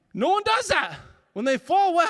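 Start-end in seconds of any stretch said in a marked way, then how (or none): 0.1-1.0 higher and louder, insisting hard; emphatic exclaim. Real, not acted. Speaker to audience, loudly insisting no one does that. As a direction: knock the idea down loudly.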